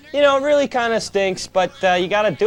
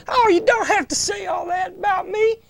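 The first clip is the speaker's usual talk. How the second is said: coy Southern Belle falsetto; high, put-on Southern Belle voice; Southern Belle accent; 9 semitones higher than usual. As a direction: demur like a flattered Southern Belle. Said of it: "get a Southern Belle accent".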